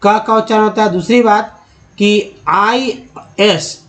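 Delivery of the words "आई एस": slow letter spelling. Shown as a demonstration. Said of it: "इसे बोलते हैं ईज".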